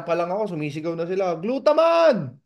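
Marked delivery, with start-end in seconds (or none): 1.4-2.3 much higher, louder call down the street; shouted nickname. Retold or imitated. Neighbours calling his nickname from the street, shouting. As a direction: yell it out to tease him.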